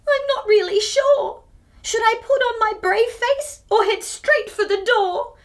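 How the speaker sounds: put-on a nervous young girl fretting aloud; anxious Clara. Reader voicing anxious Clara to children.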